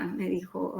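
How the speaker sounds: normal talking voice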